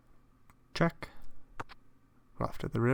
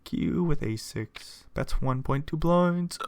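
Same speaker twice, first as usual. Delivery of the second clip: ordinary talk, nothing marked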